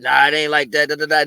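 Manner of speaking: mock defensive voice; imitated denial. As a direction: mimic someone brushing it off, low and loud. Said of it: "They be like".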